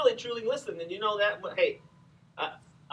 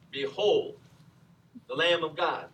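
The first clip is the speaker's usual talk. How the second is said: formal Bible reading; 4 semitones lower than usual. Retold or imitated. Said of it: "he probably didn't say it like this".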